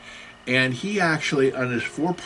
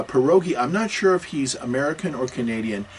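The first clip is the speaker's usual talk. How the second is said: ordinary delivery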